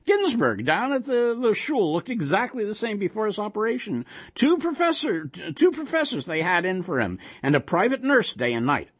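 put-on high-pitched kibitzing old husband; animated Yiddish-inflected old man; gossiping old man. A narrator's reading. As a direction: prattle on over the interruption. Old man gossips over the monster.

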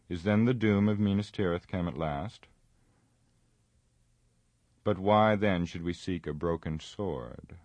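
ordinary delivery

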